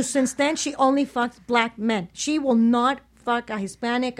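ordinary talk

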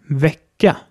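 Wecker as Swedish vecka, short open E. Shown as a demonstration. Teacher models Wecker for learners, short open E. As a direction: say Wecker with the E of Bett and an open ending. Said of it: "das kurze E".

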